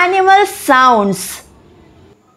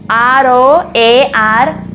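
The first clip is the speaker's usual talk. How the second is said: usual speaking voice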